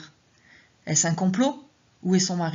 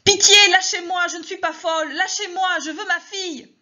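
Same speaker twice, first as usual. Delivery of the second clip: screaming plea; 12 semitones higher, 7 dB louder than usual. Acted. Begging nurses while being held.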